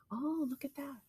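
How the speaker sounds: hushed whisper, as if sharing a secret. Acted. Teacher whispering to children over a picture. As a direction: breathe it out softly to draw the kids in.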